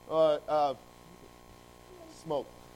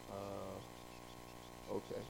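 deadpan pilot; 8 semitones lower, 16 dB quieter, much slower than usual. Acted. Pilot's flat, baffled reply.

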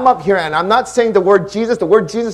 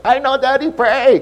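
higher, louder imitation of a little boy; son's exasperated reply; 8 semitones higher, 4 dB louder than usual. Son answering Daddy's constant reminder, imitated exasperated kid. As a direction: mimic the kid, pitched up. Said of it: "He's like".

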